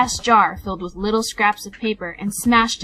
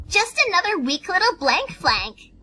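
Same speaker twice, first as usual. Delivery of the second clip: higher, snide little filly voice; snooty filly; 10 semitones higher than usual. A narrator's reading. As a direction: look down your nose at them. Silver Spoon voiced as figure.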